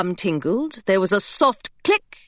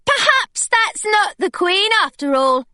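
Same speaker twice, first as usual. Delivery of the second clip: put-on much higher, gushing girl voice; eager schoolgirl; 11 semitones higher than usual. A narrator's reading. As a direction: overwhelm him with enthusiasm. Claire distracting the secretary.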